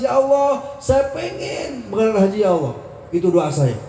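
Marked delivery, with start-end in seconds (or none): 0.0-2.7 imitated crying prayer, starting high and dropping; retold tearful plea. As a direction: replay a longing, tearful plea to God.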